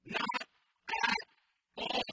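loud, emphatic not at all; a hard emphatic denial. Shown as a demonstration. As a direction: punch every word with the exclamation point. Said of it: "his response is emphatic. Note the exclamation point".

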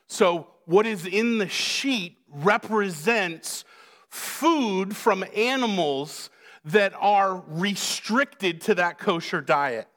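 ordinary delivery

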